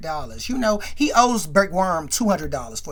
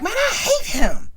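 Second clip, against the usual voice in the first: put-on high, loud girl's complaint; kid's whine; 14 semitones higher, 5 dB louder than usual. Little Megan griping about Big Worm, high and loud. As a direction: pitch it up like an upset little girl.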